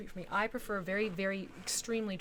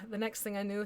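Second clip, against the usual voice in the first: ordinary delivery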